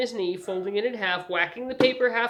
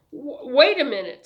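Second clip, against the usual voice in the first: stammered, louder, higher objection; flustered protest; 7 semitones higher, 8 dB louder than usual. A narrator's reading. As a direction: stumble into it, fending someone off. Man fending off an embrace.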